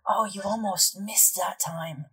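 slow, mocking taunt from the dark; taunting shadow voice. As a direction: sneer it slowly like a hidden voice. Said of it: "but you hear".

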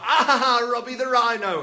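high, loud, laughing taunt; mocking laughter. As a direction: cackle the nickname at him. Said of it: "And they were all like".

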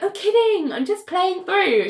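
high-pitched just-kidding tone; playful brush-off. Retold or imitated. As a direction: imitate someone airily backing off. Acting Renee brushing it off.

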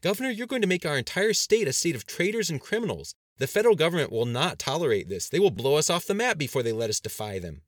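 loud, high, heated warning; impassioned, agitated objection; alarmed protest. A narrator's reading. Aide argues frantically with governor.

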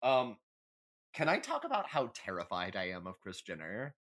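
normal talking voice

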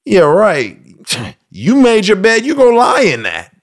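high dismissive father's brush-off; mock cold father. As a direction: sneer at the son you won't help. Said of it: "The father doesn't say".

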